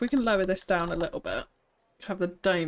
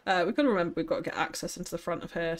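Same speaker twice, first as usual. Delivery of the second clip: usual speaking voice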